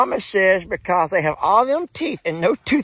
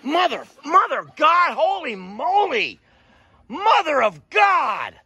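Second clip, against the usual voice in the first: high, slowed shocked cry; startled exclamation; 6 semitones higher than usual. Real, not acted. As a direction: blurt it out in real shock. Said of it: "Why am I saying mother of God?".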